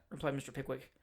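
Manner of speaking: plain reading voice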